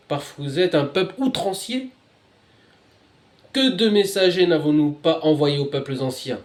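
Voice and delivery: loud, high, emphatic proclamation; declaimed scripture reading. Real, not acted. Reader warning an outrageous people from the verse, loud declamation.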